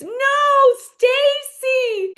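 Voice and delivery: high, loud mock scream; imitated shocked scream; Wendy screaming. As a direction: cry out in drawn-out horror, hammed up. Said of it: "And Wendy screams".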